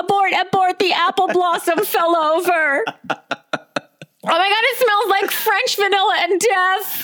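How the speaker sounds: high, panicked alarm call; mock emergency. Warning everyone of the candle fire, high mock panic. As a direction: shriek it like a hammed-up evacuation.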